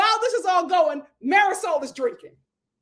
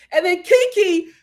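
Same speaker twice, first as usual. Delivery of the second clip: ordinary talk, nothing marked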